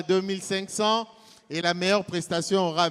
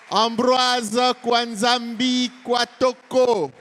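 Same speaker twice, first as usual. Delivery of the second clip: higher, drawn-out name announcement; announcer call; 5 semitones higher, 4 dB louder, slower than usual. Acted. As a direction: call the name out to the hall slowly. MC introduces president to applause.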